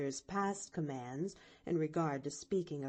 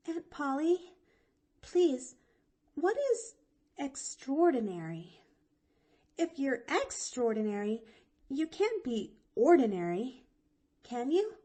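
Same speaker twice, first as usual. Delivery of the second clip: put-on high, eager little girl; lively girl voice pitched higher; young girl voice; 7 semitones higher, 4 dB louder than usual. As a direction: chirp it out like a bright chatty child. Pollyanna asks puzzled.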